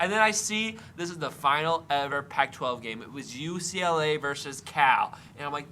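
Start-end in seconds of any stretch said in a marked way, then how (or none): none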